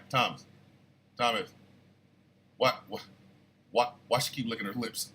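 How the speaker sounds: low, quiet imitation of a wife; softly needling under the breath; wife's hushed aside. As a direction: murmur it low, like a puzzled wife nudging her husband. Retelling wife's sneaky asides.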